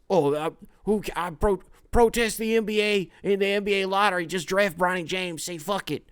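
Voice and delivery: over-the-top mock executive; hammed-up desperate GM. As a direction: play a crazed GM demanding LeBron's son. Mocking a GM holding league hostage.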